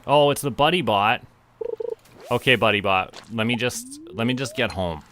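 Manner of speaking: ordinary talk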